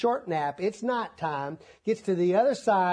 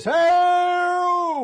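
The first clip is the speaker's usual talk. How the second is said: sudden high yell, full force; madman's shout; 12 semitones higher, 8 dB louder than usual. Retold or imitated. Preacher imitating the madman yelling into the night. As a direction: cry out like the wild man in the tombs.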